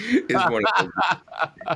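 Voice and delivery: words broken up by a real laugh; cracking up while teasing the guest. Real, not acted. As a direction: laugh through the words mid-joke. Host laughs at own joke.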